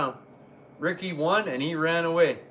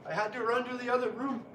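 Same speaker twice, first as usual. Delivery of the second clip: ordinary delivery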